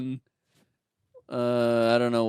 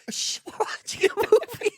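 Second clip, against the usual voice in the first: much higher, put-on prissy shush; mock shushing friend. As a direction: hush them like an annoyed moviegoer.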